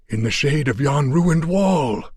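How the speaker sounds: thirsty Afghan croaking out directions; croaked. A narrator's reading. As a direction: push the words out through dry lips. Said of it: "he croaked".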